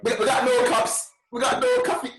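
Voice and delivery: hammed-up high sketch voice quoting Come Fly With Me; high falsetto sketch voice. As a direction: squeak it like a comedy sketch character. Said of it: "No paper cups".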